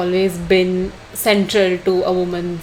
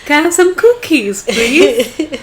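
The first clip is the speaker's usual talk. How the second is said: high, playful put-on movie voice; movie character impression; 7 semitones higher than usual. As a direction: put on a higher, hammy film-character greeting. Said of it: "That's a great impression from one".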